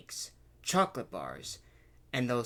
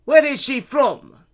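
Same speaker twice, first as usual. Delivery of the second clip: high, slow, booming question; demanding vendor; 11 semitones higher, 16 dB louder than usual. A narrator's reading. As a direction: interrogate loudly, drawing it out. Luigi keeps interrogating.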